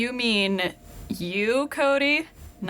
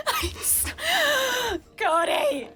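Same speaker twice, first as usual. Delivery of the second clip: high, wailing half-laughed sobs; mock crying plea; 10 semitones higher, slower than usual. Co-host pleading with Cody to stop, mock sobbing. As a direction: beg him to stop through fake tears.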